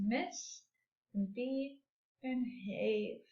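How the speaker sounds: "Miss and be and have" softly, with pauses between pieces; counted syllables. Shown as a demonstration. Teacher listing three syllables for pupils, softly spaced. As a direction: set "Miss", "be" and "have" apart quietly. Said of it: "We have three syllables".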